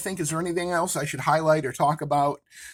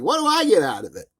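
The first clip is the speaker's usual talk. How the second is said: put-on a sly kid's Chicago gangster voice; a street kid haggling for his cut, Chicago gangster style; cheeky kid; 4 semitones higher, 6 dB louder than usual. Kid asks Kirk what's in it for him, sly Chicago gangster voice. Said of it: "And the kid's like".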